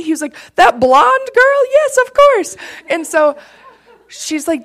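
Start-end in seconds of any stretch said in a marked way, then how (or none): 0.5-2.6 high, loud imitation of an old man; excited dad. Dad on the phone recognising his daughter's helper, high excited imitation.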